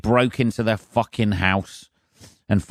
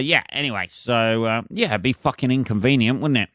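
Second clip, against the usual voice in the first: normal talking voice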